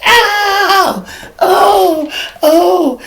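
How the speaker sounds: high, loud hurt yelp; mock cry of pain. Cop shot by the woman, loud high yelp.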